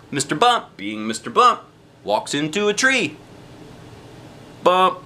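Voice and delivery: ordinary delivery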